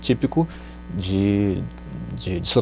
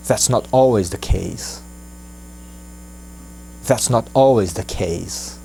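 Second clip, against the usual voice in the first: slow and carefully spaced; slow model phrase; slower than usual. Shown as a demonstration. Teacher modelling the sentence slowly for students. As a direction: say it slowly for the class to copy. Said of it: "slow model phrase".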